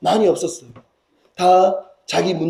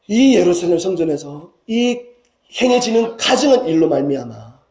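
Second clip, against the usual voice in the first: normal talking voice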